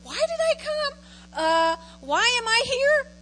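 mock-bewildered churchgoer asking why; acted questioning. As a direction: pitch climbing well up, drawn out. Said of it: "and we go".

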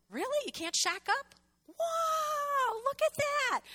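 high, astonished "wow"; amazed. Retold or imitated. Young self reading the Bible, astonished, high. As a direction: sound shocked at the discovery.

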